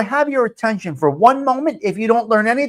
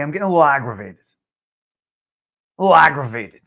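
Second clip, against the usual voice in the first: usual speaking voice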